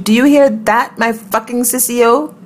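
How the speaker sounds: high, slowed, half-muttered comeback. A narrator's reading. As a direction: throw the phrase back with irritation. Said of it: "I muttered".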